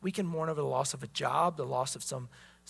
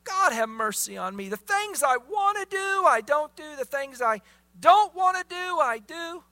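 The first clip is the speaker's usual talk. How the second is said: crying out like Paul; 8 semitones higher, 7 dB louder than usual. Retold or imitated. Preacher voicing Paul's desperate cry to the congregation, high and loud. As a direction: cry it out like a man at the end of himself. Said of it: "do we cry out like Paul".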